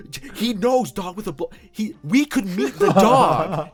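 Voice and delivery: high, loud, disbelieving squeal; girlfriend freaking out. Retold or imitated. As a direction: shriek it like you can't believe it. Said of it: "She started freaking the fuck out".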